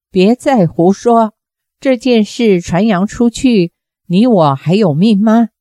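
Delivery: plain reading voice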